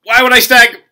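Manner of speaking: high, loud mock-indignant yell; outraged interruption. Player cutting off the judges, mock-outraged shout. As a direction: burst in with exaggerated disbelief. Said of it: "I interrupted them".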